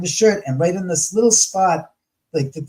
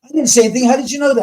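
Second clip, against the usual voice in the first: retold astonished protest, higher and louder; shocked disbelief; 8 semitones higher, 5 dB louder, much faster than usual. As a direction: sound floored that he knew. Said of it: "He was like".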